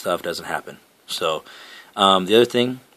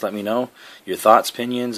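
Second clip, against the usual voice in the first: normal talking voice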